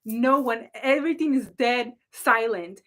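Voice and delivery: ordinary talk, nothing marked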